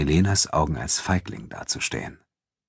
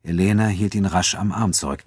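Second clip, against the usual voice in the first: ordinary delivery, nothing marked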